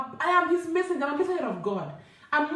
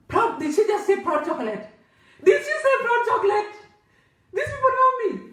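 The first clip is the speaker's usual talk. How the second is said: high, rising astonished retelling, growing louder; amazed disbelief; 8 semitones higher, 5 dB louder than usual. Retold or imitated. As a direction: act out being stunned at being recognised. Acts amazed reaction to being recognised.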